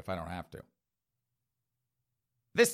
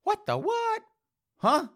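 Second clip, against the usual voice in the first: squeaky falsetto disbelief, much higher; mock high-pitched confusion; baffled listeners. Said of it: "some of you out there going".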